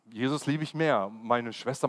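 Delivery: ordinary talk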